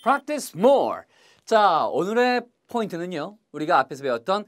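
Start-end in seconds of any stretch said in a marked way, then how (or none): none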